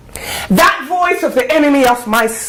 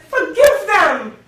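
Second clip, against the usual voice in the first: raised, emphatic exhortation; impassioned plea; 6 semitones higher than usual. Acted. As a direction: plead it out forcefully. Urgent plea to forgive.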